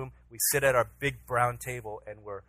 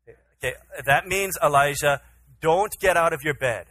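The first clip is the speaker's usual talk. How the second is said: raised, louder voice, firm bedtime order; stern dad warning; 3 semitones higher, 9 dB louder than usual. Retold or imitated. Father telling his son to stay in bed, stern. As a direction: spell out the rule for a small child.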